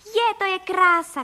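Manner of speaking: high, loud, drawn-out glee; delighted shout. A narrator's reading. Boy flying over the world, delighted shout. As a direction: cry out slowly in delight. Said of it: "křičel Vilda".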